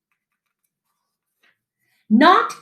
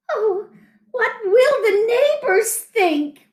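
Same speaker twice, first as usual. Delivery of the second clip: much higher fretting mother; worried mother; 11 semitones higher than usual. A narrator's reading. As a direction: fuss about the neighbors. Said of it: "worried his mother".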